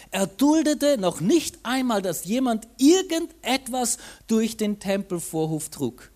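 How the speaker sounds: measured Bible reading to the congregation; scripture reading. A narrator's reading. Preacher reading the temple story to the church, measured. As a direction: read the gospel passage out, steady and clear.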